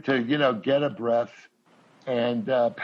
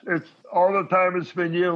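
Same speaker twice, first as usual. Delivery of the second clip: Ray impression; 5 semitones higher than usual. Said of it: "Ray goes".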